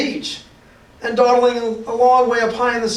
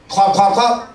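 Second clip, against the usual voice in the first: clopping like horse feet; horse hooves; 3 dB louder than usual. Shown as a demonstration. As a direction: tap out hoofbeats. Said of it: "like horse feet".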